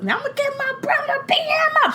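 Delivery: very high child voice, mock angry; mad little daughter. Daughter threatening to fetch brothers, imitated anger. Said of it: "She was mad".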